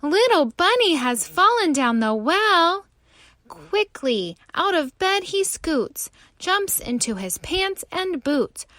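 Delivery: usual speaking voice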